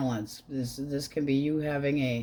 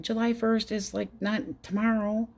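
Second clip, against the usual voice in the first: mock exasperated; 7 semitones higher than usual. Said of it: "And I'm going".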